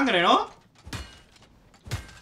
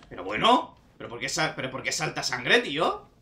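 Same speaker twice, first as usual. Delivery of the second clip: ordinary talk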